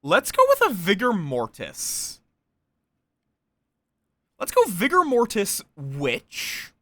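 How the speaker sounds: ordinary talk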